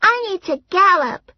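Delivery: put-on very high whiny little girl. A narrator's reading. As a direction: whine like a small girl squeezed at the table. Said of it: "Mary Alice said".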